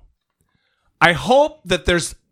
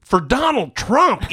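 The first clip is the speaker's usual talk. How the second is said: ordinary talk, nothing marked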